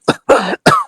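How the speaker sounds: harsh rasping sick man's cough; a dying man's hacking cough; hard cough. Acted. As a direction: cough hard like failing lungs. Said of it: "You see him cough, like, hard".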